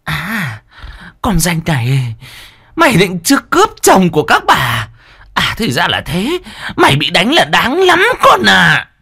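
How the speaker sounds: gritted-teeth fury. A narrator's reading. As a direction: spit it through gritted teeth. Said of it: "nghiến răng nghiến lợi".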